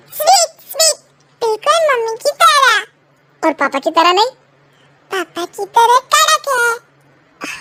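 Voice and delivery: put-on a boy teasing sweetly; sweet child. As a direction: son comparing mom and dad. Child praises tea.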